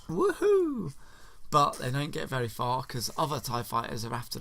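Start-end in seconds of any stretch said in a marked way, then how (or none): 0.1-0.9 very high, loud cheer; excited high woohoo; gleeful whoop. Real, not acted. Celebrating the escape.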